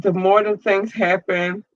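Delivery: usual speaking voice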